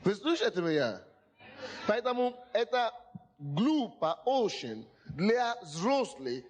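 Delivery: ordinary talk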